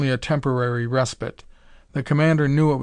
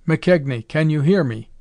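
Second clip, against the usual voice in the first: raised, mildly excited call; excited cry; 4 dB louder than usual. A narrator's reading. Commander hailing his lost cook, mildly excited call. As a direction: call out hoping to be heard. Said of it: "the commander cried excitedly".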